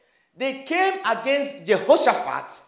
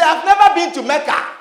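fast shouted punchline; hammed-up indignant patient; loud mock protest; 6 semitones higher, 8 dB louder than usual. Patient insists to the doctor, loud and fast.

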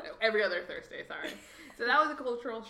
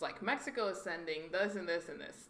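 ordinary delivery

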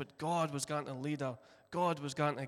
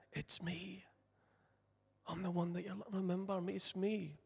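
soft reassurance; 3 semitones higher, 6 dB quieter than usual. Pretend. Imagined Jesus speaking softly.

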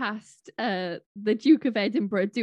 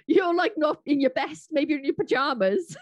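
usual speaking voice

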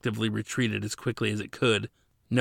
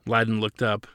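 usual speaking voice

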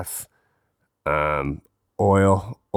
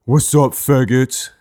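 slow, higher, louder mock tough guy; brash put-on greeting, pitched up; imitating Bryce; 6 semitones higher, 5 dB louder, slower than usual. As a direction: strut in and trash talk like a small guy.

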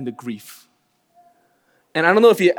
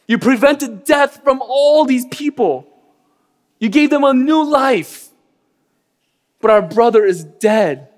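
raised pitch, slow, hurt and accusing; angry accusation; 6 semitones higher, 3 dB louder than usual. Pretend. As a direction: rail at Jesus as a grieving sister. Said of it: "I'll be angry. I'll be over the top".

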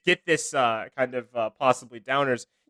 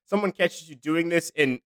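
usual speaking voice

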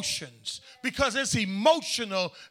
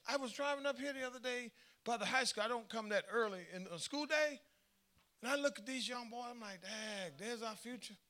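much softer, quick aside; soft storytelling aside; 2 semitones higher, 12 dB quieter than usual. Retold or imitated. Preacher to congregation, a soft quick anecdote aside. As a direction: tell it quietly as a side story.

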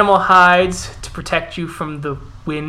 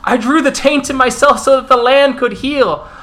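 higher, louder desperate defense; pleading bear shifter; 7 semitones higher, 4 dB louder than usual. Acted. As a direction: beg them to believe you. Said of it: "As the Gorel goes".

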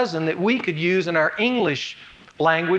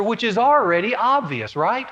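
usual speaking voice

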